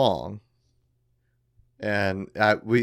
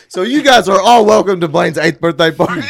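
loud announcement; 8 semitones higher, 12 dB louder than usual. Real, not acted. Announces party after joke.

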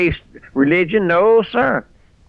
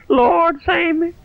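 crying out to the Lord; slower than usual. Pretend. Preacher voicing a repentant sinner crying out to the Lord, high and sobbing. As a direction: wail it like a sinner begging to be saved. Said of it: "You'll come back crying".

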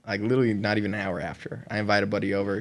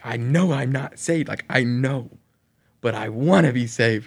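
raised, higher mock yell at God; retold yelling; 4 semitones higher, 6 dB louder than usual. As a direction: imitate a desperate yelled prayer. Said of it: "I keep yelling at him".

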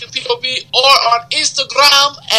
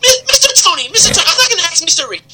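high-pitched put-on voice, pleading; pleading comic character; 8 semitones higher, faster than usual. Character begs to tell the joke.

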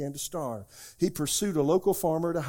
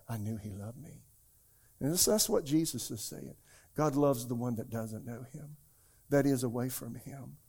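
ordinary delivery

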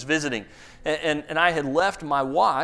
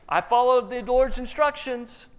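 innocent Saul; 9 semitones higher than usual. Acted. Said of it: "So Saul says".

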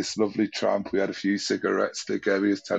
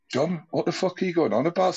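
exasperated; 9 semitones higher than usual. Retold or imitated. Retold exasperated outburst at John.

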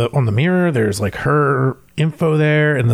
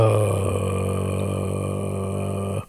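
long, very low, soft droning "uh"; imitated rumble; 8 dB quieter than usual. Player showing friend the noise outside, imitated low rumble. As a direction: hold a deep, steady rumble in the throat. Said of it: "on the doors, it's like".

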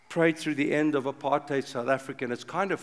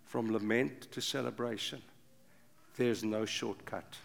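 normal talking voice

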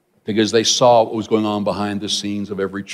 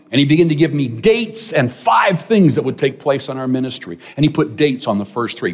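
ordinary talk, nothing marked

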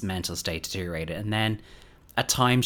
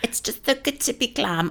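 mock Dorit gushing; 13 semitones higher than usual. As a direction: ham up a vain gush over her own hair. Mocking Dorit's glam line again.

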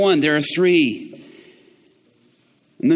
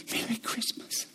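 breathy whispered Merry Christmas; 5 semitones higher, 14 dB quieter than usual. Retold or imitated. Believers at the door, whispering tearfully. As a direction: whisper it softly through tears. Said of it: "whispering it".

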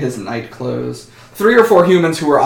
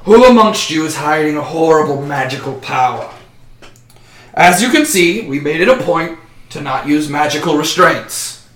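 commanding official; 3 semitones higher, 3 dB louder than usual. Acted. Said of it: "And he says".

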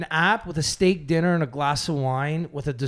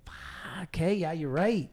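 drawn-out, softer admission of defeat; grudging concession; 3 semitones higher, 7 dB quieter, slower than usual. Retold or imitated. Telling how he conceded to his brother, grudgingly. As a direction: give in slowly, dropping the volume. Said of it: "I was like".

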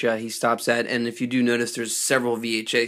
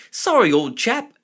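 high, loud mock British accent; hammed-up old-chap British voice; put-on British accent; 12 semitones higher, 5 dB louder than usual. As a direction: imitate a British gent, pitched up and loud. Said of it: "a moment ago he was British".

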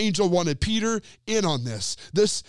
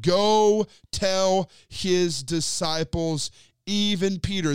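"Go tell his disciples" drawn out slowly and firmly; slow, deliberate command; slower than usual. Acted. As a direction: lay out each word of "Go tell his disciples" like an order. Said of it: "The angel of the Lord ... said".

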